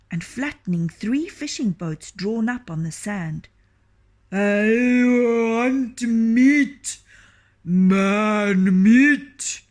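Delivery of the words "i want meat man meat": very slow, much louder giant voice; hungry ogre. Acted. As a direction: drag out each word like a starving ogre. Ogre demands man meat.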